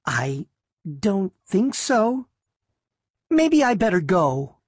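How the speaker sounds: halting, high-pitched uncertainty; falter, then retreat in a small high voice; hesitant girl, mildly frightened; timid hesitation. A narrator's reading. Frightened girl backs away.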